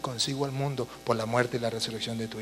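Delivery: low, soft, intimate prayer; personal prayer. Shown as a demonstration. Priest addressing the Father as if seeing him, hushed personal prayer. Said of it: "a mí me gusta hablarle personalmente".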